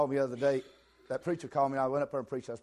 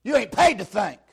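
loud, high preaching shout; shouting preacher; 7 semitones higher, 12 dB louder than usual. Real, not acted. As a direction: preach it at full force. Preacher building to full force.

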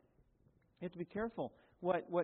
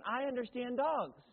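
slow, earnest mock of Brother Don, pitched higher and louder; imitating Don; 5 semitones higher, 5 dB louder, slower than usual. Pastor mimics Don boasting to the congregation, slow mock earnestness. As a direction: play him as a solemn little puppy, slowly. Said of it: "This is exactly what he looked like".

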